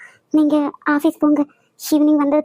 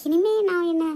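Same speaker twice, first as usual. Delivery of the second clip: soft and tender; 4 semitones lower, 9 dB quieter than usual. Acted. Wife speaks softly to husband.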